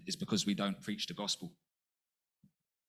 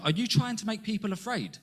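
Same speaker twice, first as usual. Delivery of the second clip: challenging question; 10 semitones higher than usual. Retold or imitated. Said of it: "Someone said to him".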